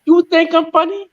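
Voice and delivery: high, mocking tough-guy question; movie gangster impression. Mimicking a movie tough guy, pitched high. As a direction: needle them like a screen gangster. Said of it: "The actor".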